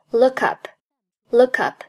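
"look up" run together as "loo-kup"; linked pronunciation. Shown as a demonstration. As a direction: link the k of "look" straight into "up". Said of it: "look和up连读".

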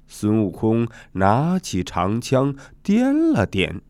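ordinary delivery, nothing marked

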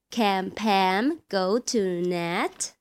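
Nat? rising at the end, said slowly; rising question tune. Shown as a demonstration. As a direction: lift the tune up on Nat, slow and clear. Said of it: "语调上扬". (一般疑问句，Nat 语调上扬)

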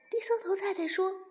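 ordinary delivery, nothing marked

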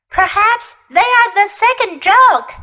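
high jackdaw voice; suggesting jackdaw. A narrator's reading. Jackdaw guessing at the strangers, high and suggestive.

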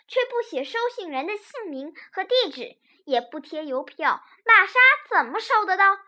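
loud, high, agitated scolding; excited cry. A narrator's reading. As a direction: burst out, worked up over the lost letter. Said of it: "司机法兰克激动地叫".

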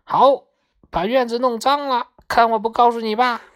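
high parrot voice threatening to tell. A narrator's reading. Parrot scolds Maomao over the dirty yard, high threatening voice. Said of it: "绿鹦鹉说".

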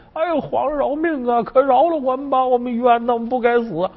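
a crowd of grooms begging for their lives, hammed up; mock sobbing plea. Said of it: "痛哭流涕". (假装痛哭，尖声哀号求饶)

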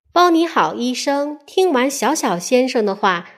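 ordinary delivery, nothing marked

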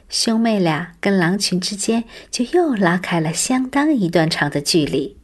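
usual speaking voice

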